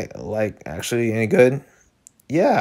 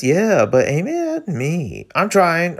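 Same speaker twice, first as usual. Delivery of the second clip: ordinary talk, nothing marked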